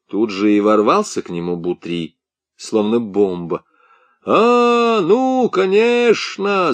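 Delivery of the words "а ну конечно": high, slow mocking yell. A narrator's reading. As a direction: yell it from the doorway. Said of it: "заорал он с порога".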